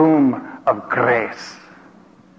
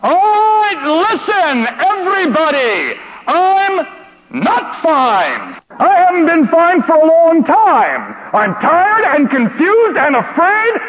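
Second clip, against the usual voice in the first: yelling confession; 10 semitones higher than usual. Retold or imitated. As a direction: shout it all out to the room. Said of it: "and I yell out".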